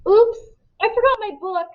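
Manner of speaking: bright, high fake-innocent kid voice; lightly voiced sly boy; mock innocent. A narrator's reading. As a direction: sing out a fake excuse. Dylan feigning innocence to teacher.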